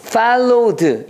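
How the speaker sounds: "followed" said out clearly as a model; pronunciation demo. Shown as a demonstration. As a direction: model the word "followed" for learners. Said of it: "이렇게 말하면 되겠습니다". ("followed" 발음 시범)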